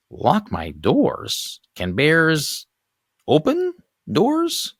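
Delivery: put-on high, slow disbelieving old lady; incredulous old neighbor. Neighbor doubting the bear warning, high and disbelieving. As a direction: squeak out the question in surprise. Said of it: "Sobalski asks about bears".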